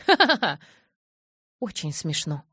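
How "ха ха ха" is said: high, loud teasing ha-ha-ha; hammed-up guffaw; mocking laugh. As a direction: laugh right in her face. Jacob laughs at his joke.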